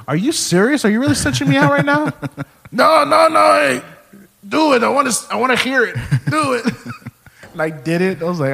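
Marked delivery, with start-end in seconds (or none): 0.1-2.1 high, incredulous retold outcry; mock indignant protest. Acted protest at being told on. 2.7-6.6 very high, urging grandpa voice; insistent grandpa impression. Grandpa demands to hear the impression.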